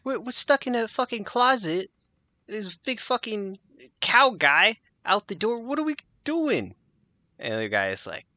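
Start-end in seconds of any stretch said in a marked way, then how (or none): 0.0-6.8 high, frantic whine; trapped and losing it; panicked prisoner. Acted. Prisoner panicking to Ron, high and frantic.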